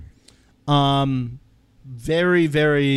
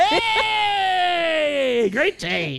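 sharply raised mock cheery hey; silly high greeting; much slower than usual. Host cuts in with a goofy high greeting. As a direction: pop in with a high goofy hello.

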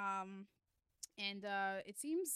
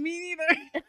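laughing hard through the words, much higher and louder; 10 semitones higher, 18 dB louder, slower than usual. Real, not acted. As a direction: burst out laughing at the jargon. Both laughing at business words.

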